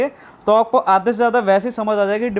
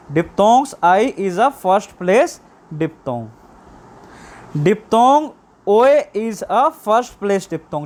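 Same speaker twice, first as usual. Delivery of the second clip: slow dictation; much slower than usual. Shown as a demonstration. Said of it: "teacher dictates rule slowly".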